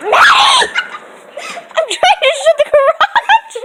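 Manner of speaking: loud, high laughing shout; shouting at a dog. Real, not acted. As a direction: call the dog back, laughing.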